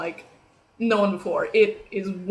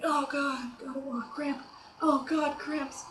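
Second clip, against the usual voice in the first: mock out of breath; 4 semitones higher, 7 dB quieter, slower than usual. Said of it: "I sort of, like".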